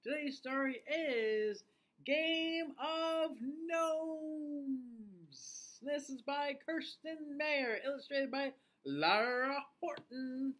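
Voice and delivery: bright, high host greeting; kids show host. Acted.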